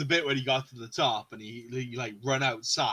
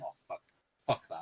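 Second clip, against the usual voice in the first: retold muted dread; 8 semitones lower, 6 dB quieter than usual. Retold reaction while watching gameplay.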